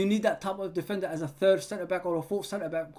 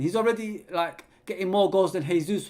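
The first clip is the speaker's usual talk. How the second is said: ordinary talk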